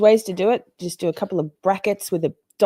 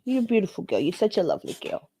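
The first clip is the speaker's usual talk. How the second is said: dog voice; 4 dB quieter than usual. Real, not acted. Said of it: "That's my dog voice".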